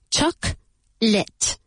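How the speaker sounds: "Chocolate" split slowly as CHOC, pause, LIT; slow syllable-by-syllable "Chocolate", no middle vowel; two-syllable pronunciation. Shown as a demonstration. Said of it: "Careful with the pronunciation of chocolate".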